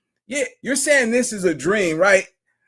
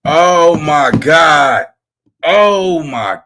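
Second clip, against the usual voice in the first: shocked exclamation; 5 semitones lower, 6 dB louder, much slower than usual. Real, not acted. Stunned reaction to clip.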